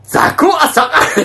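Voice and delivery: a coworker's loud order, retold through laughter; imitating a loud shout. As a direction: bellow it at the part-timers while cracking up. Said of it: "むっちゃでかい声で言ったんよ".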